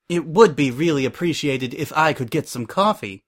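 ordinary delivery, nothing marked

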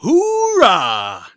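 thundering radio shout. Acted. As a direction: blast it over the airwaves. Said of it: "voice thundered over the airwaves".

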